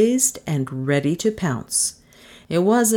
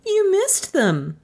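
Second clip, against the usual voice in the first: high, slow accusation; accusing Melissa; 14 semitones higher, slower than usual. Acted. Passenger blaming the driver, high and accusing.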